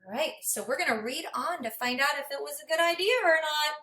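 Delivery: ordinary talk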